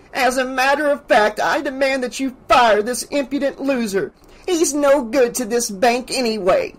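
high, haughty outrage; sputtering indignation. A narrator's reading. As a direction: demand it as the offended customer. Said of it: "Missus Deagle sputtered".

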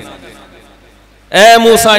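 long, loud, stretched-out call of the name; drawn-out call. Retold or imitated. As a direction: call the name out slowly, savouring it. Allah calling Moses fondly.

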